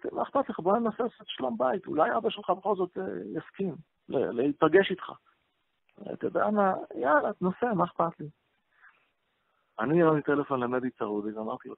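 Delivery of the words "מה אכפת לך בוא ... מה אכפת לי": eager urging. Retold or imitated. Retelling urging the son to try.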